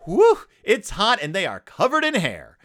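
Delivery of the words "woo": high, loud "woo"; heat-struck whoop. Acted. Host acts out a hot day, loud whoop.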